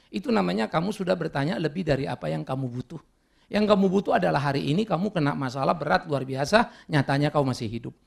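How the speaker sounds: ordinary talk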